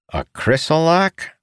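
incredulous high question; surprised. A narrator's reading. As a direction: gasp it out in disbelief. Astonished question from crowd.

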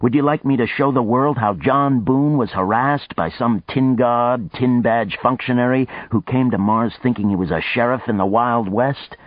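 mocking taunt. Boone taunting Houston.

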